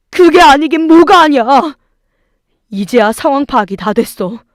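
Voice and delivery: loud, high-pitched angry tirade; furious yelling. A narrator's reading. Husband berating mother and sisters, furious yelling. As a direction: berate them at full voice. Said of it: "남편은 저보다 더 화가 나서 악을 썼습니다".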